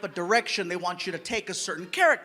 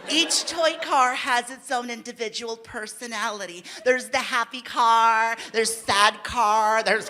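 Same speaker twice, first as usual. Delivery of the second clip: lightly voiced director. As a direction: explain it like a careful director. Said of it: "She's like".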